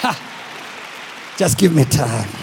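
low, slow promise with long pauses; calm them with low, weighty assurance; steady reassurance. Acted. Captain asking passengers for time, low and reassuring.

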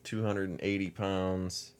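drawn out, each number lingering; a caller slowly giving a missing woman's size; slow deadpan. Retold or imitated. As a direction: spell it out slowly as if it's normal. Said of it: "She's like".

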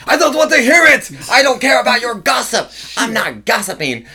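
put-on very high, loud German-accented shout; German old lady yelling. German old lady refusing to hear gossip, yelling. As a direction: yell it like a fed-up German grandmother. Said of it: "our German elderly woman".

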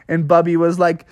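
plain reading voice

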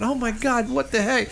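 a hushed, high "oh my God" aside; retold shock. Host recalls hearing about the gunshots, in hushed, high disbelief. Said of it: "I'm thinking".

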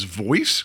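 "voice" with a rising question tune; questioning read. Retold or imitated. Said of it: "question mark".

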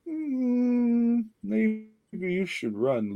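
high, slow mock-heroic plea with a drawn-out "Mm"; lightly hammed-up noble sacrifice voice, drawn out; mock heroic warning. Mock dramatic warning to Leroy.

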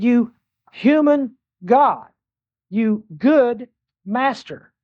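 drawn out, each word weighed slowly; slow, pointed imitation of a flatterer; slow mock address. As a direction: spell out the man's flattering title slowly. Preacher acts out the man's address.

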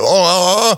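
short strained oh sounds; hammed-up pushing grunts; mock straining groans. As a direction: groan as if squeezing through. Acting out squeezing through.

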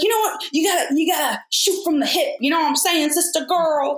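high, hammed-up patronising director voice. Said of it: "he's like".

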